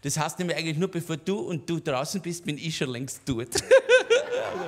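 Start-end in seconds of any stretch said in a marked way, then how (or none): 3.5-4.5 loud, high burst of laughter. Real, not acted. Comedian bursts into loud laughter at his own joke. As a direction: crack up at the punchline.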